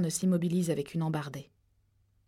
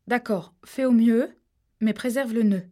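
firm order; 4 semitones higher, 7 dB louder than usual. Acted. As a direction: call it up the tree, deliberate. Rachel gives orders, voiced. (Rachel donne ses consignes à Harold, ferme et forte)

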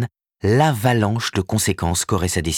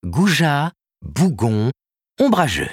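higher, teasing list of insults; lightly voiced Karen, mocking; 8 semitones higher, 3 dB louder, slower than usual. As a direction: toss each insult at him playfully. Karen lists his faults.

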